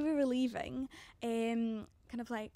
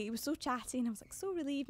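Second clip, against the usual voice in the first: normal talking voice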